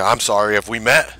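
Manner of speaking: usual speaking voice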